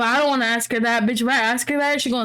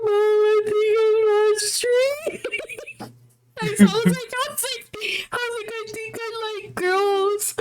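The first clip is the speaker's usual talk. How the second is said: small high voice; 9 semitones higher than usual. Retold or imitated. Retelling tearful coming out.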